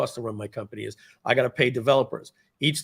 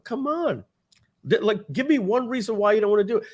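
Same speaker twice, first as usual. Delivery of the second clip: coaxing brother; 9 semitones higher than usual. Retold or imitated. Retold sitcom brother pushing.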